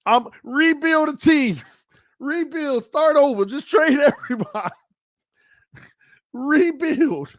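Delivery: excited rant. Real, not acted. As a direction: rattle it off like a fan losing it. Fan rants about trading everyone.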